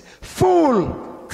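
high, loud shout on one word; sharp rebuke. Shown as a demonstration. Preacher showing how the Lord said it, sharp rebuke. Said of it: "firm and sharp rebuke".

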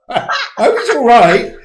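high, loud words through real laughter; laughing. Real, not acted. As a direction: say it while cracking up. Laughing at colleague's fall.

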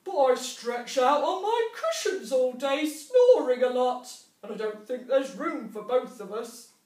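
a grumpy badger snapping no; barking badger. A narrator's reading. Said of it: "barked Badger".

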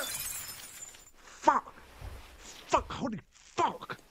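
pained cursing. Acted. Swearing from sudden pain.

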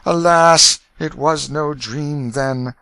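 loud despairing cry, then slow; groaning lament. A narrator's reading. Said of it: "with a groan and the ejaculation".

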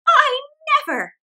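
high, loud startled exclaim; astonished. Acted. Old woman sees silver, astonished. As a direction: gasp out surprise. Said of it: "she said again".

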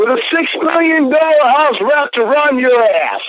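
high, scornful yell; real high-pitched outrage; angry yelling. Real, not acted. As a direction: yell it as a furious taunt. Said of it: "You're yelling at me".

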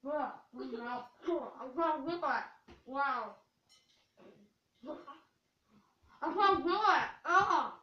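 talking through dry crackers; mouth full of crackers. Real, not acted. Mouth stuffed with saltine crackers.

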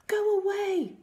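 high, loud alarmed cry; gasped queen. A narrator's reading. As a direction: send the intruder away in a high gasp. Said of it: "the Queen gasped".

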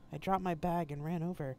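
usual speaking voice

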